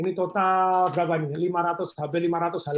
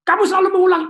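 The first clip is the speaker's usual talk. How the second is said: angry accusation; 13 semitones higher, 9 dB louder, faster than usual. Retold or imitated. Role-played quarrel, angry spouse.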